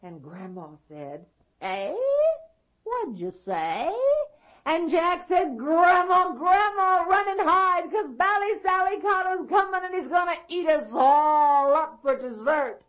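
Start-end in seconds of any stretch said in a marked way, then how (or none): 1.6-3.9 lightly voiced old granny, questioning rise; a hint of a deaf old woman asking; hard-of-hearing grandma. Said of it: "being hard of hearing like Grandpa". 5.6-12.8 high, loud hollered warning; boy yelling urgently at high pitch; shouting. Acted. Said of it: "you don't need to shout so".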